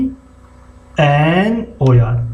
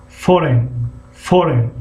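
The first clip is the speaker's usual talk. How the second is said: usual speaking voice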